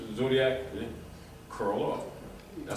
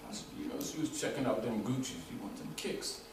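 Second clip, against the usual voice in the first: softer sly witch offer, ending in a whisper; mock witch tempting; 8 dB quieter than usual. Retold Oz, the witch tempts Dot with shoes, hushed and sly. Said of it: "She said".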